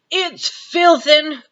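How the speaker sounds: slow, high splutter through a mouthful; spray the word out past chewed food; spluttering mouth full. Acted. Giant chewing snozzcumber at Sophie, spluttering. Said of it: "he spluttered".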